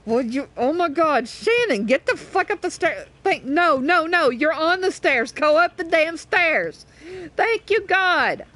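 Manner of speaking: real gamer rage, drawing out the last words; exasperated. Real, not acted. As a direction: lose patience and order them upstairs. Raging at stuck game character.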